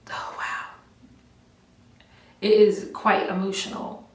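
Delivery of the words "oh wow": a breathless whispered oh wow; hushed. Real, not acted. Looking back at her emotional video, whispered awe. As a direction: barely breathe it out in surprise.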